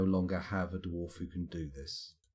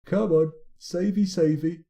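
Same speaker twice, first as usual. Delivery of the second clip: high, loud, drawn-out coaxing; pleading coax; 9 semitones higher, 7 dB louder than usual. Real, not acted. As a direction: coax it like urging a pet. Urging game save.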